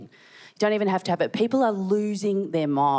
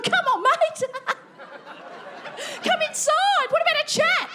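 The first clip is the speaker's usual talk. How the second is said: coaxing. Retold or imitated. Neighbour in underwear chasing a magpie, high coaxing call. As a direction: coax a bird like a madman. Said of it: "Just going".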